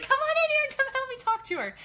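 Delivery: high loud eager plea; high eager voice of the sister-in-law; excited urging. Retold or imitated. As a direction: call her in excitedly. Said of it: "She's like".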